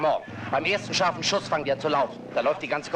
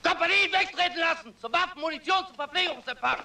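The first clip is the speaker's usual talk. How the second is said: barked command. Acted. Sergeant relaying the colonel's orders.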